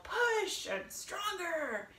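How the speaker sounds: high, drawn-out cheer at ordinary volume; mock yell. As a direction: imitate an overeager coach's drawn-out yell. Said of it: "tempting to want to yell".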